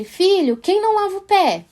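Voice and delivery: pergunta aguda de mãe para filho pequeno; pergunta à criança. Acted. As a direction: puxe a resposta da criança, subindo a voz. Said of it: "Depois você pergunta pra ele". (mãe perguntando ao filho em tom mais agudo)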